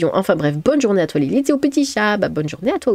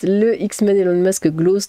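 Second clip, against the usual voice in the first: normal talking voice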